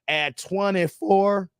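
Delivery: ordinary talk, nothing marked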